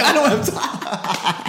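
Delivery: high, slow mock whine through laughter; put-on high cartoon protest; imitated cartoon exasperation. Acts SpongeBob's exasperation, laughing.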